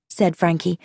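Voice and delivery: usual speaking voice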